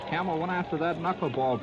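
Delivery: usual speaking voice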